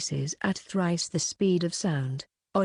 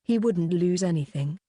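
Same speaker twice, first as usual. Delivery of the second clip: usual speaking voice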